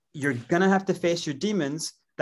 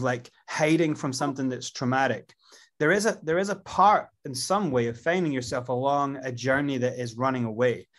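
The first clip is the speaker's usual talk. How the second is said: normal talking voice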